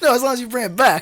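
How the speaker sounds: fast mock-indignant reply; playful retort. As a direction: snap back fast and bright. Playful rebuttal in character.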